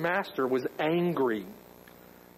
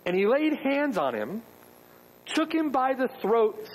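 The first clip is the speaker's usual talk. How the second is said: usual speaking voice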